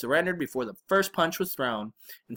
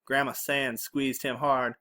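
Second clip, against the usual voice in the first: usual speaking voice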